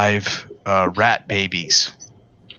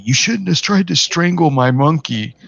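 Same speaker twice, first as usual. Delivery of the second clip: mock yell; 7 semitones higher, 3 dB louder than usual. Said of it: "the guy was yelling at me".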